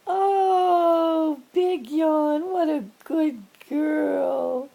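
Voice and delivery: loud, high, delighted cooing; excited pet praise. Real, not acted. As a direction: gush over the cat, stretching the words. Excited praise after cat yawns.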